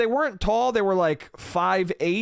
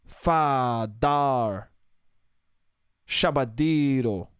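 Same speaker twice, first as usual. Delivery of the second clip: low, drawn-out nonsense syllables; slow mock foreign-sounding gibberish with long falling endings; imitated model gibberish; 4 semitones lower, slower than usual. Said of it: "they were talking like this".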